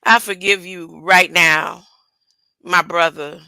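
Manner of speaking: tender Jesus forgiving Judas; Jesus gently. Retold or imitated. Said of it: "He said".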